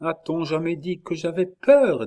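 tight-lipped retort. A narrator's reading. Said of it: "dents de ses lèvres minces".

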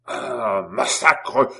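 loud, exploding oath; enraged yell. A narrator's reading. Cobbler livid at the sergeant's dare, yelling. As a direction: burst out in rage on the second word. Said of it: "hurla Simon, blêmissant de rage".

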